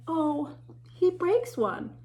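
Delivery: ordinary delivery, nothing marked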